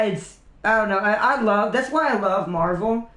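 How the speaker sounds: ordinary talk, nothing marked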